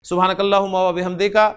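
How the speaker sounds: low, quick closing supplication; recited prayer. Real, not acted. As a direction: murmur a memorised Arabic prayer rapidly and low.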